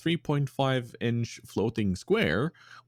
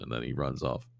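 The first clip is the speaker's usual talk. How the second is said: normal talking voice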